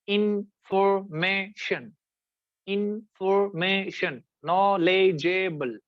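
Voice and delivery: इन्फॉर्मेशन stretched very slowly, syllable by syllable; slow syllable demo. Shown as a demonstration. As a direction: sound out इन्फॉर्मेशन and नॉलेजेबल slowly in beats. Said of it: "क्या इसको हम कहते हैं".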